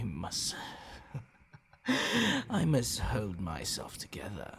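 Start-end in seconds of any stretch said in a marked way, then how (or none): 1.8-2.4 a sudden high breathless laugh; giddy laugh. Acted. Vampire fighting her bloodlust, sudden high laugh. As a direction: burst out laughing, barely holding back the hunger. Vampire losing self-control.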